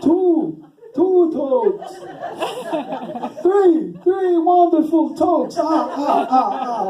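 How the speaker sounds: high, loud, drawn-out puppet count; hammed-up theatrical counting voice. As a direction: count with relish and end on a staccato villain laugh. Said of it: "I always picture him going".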